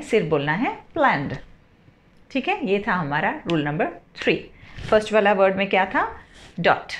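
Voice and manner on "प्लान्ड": प्लान्ड as one syllable ending in d. Shown as a demonstration. Teacher showing students प्लान्ड ends in a plain d. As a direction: say प्लान्ड with only a d at the end. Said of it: "pronunciation यहाँ पर अब ड का आएगा सिर्फ".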